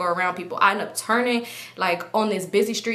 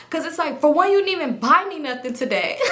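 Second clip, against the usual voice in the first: ordinary delivery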